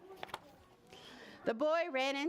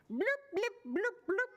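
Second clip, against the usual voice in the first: high popping bloops; fish language; 10 semitones higher than usual. Acted. Boy now greeting the fish in fish language, high bloops. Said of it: "this time it sounded more like".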